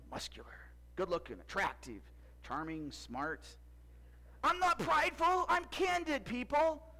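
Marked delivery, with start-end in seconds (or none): 4.4-6.9 mock indignant. Joking self-praise.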